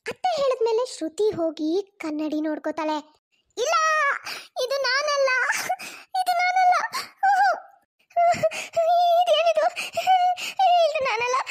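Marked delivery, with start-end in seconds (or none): 3.6-5.3 dismayed denial. Acted. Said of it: "ಅಷ್ಟೊಂದು ಅಳ್ಬೇಡ ಶ್ರುತಿ". 6.2-11.4 crying. Acted. Wife sees her bald face in the mirror, wailing in tears. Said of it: "ಅಷ್ಟೊಂದು ಅಳ್ಬೇಡ ಶ್ರುತಿ".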